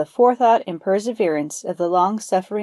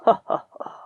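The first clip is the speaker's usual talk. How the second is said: whispered gloating tiger chuckle; happy laugh. Acted. Tiger gloating over his meal, whispered chuckle. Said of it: "Then he laughed happily".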